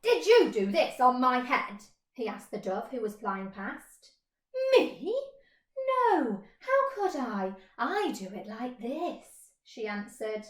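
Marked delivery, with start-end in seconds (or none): 0.0-1.8 louder, pointed question; accusing question. Acted. Mole accusing the dove, loud and suspicious. 4.7-9.5 higher, airy bird voice; dove voice. Acted. Dove denying it to the mole, light and high.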